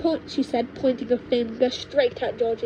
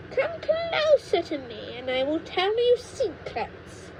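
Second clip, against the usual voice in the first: witch grandma beckoning; 7 semitones higher than usual. Acted. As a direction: lure the boy in like a witch. Witch grandma luring George.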